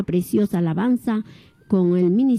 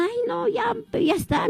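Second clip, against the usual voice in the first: much higher, softer, fed-up sigh of a line; exasperated dismissal; 12 semitones higher, 4 dB quieter than usual. Retold or imitated. Shopkeeper giving up on a fussy pregnant customer, exasperated. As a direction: sound like a shopkeeper whose patience just ran out. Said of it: "si yo ese día".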